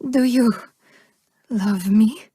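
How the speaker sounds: ordinary talk, nothing marked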